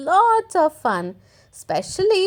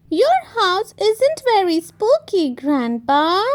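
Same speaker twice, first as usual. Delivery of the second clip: high little piglet voice; Peppa teasing; 5 semitones higher than usual. Acted. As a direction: tease grandpa like a child.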